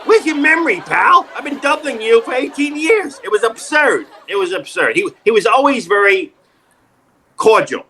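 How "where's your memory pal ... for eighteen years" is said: high, incredulous comic scolding; hammed-up indignation; mock exasperation. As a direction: scold a forgetful pal, pitched up. Acted exasperated reply to Phil.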